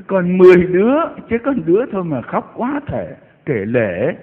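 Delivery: high, incredulous mockery; mocking exclamation. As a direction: scoff loudly at the excessive wailing. Mocking the mother's wailing.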